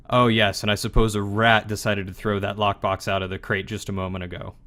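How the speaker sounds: normal talking voice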